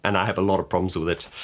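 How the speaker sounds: usual speaking voice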